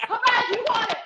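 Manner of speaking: high, pushy coach voice; imitating coach urging. Coach in her corner urging the exhausted fighter on, high and pushing.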